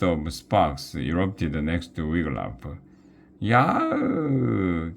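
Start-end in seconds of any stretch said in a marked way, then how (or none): 3.4-4.9 high startled troll cry; a high cartoon troll yelp. A narrator's reading. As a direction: yelp as sparks hit. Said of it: "came the troll's voice".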